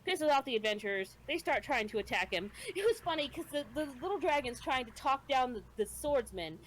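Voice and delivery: ordinary talk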